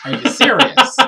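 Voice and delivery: loud high disbelief. Real, not acted. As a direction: shout your disbelief. Repeated exclamation.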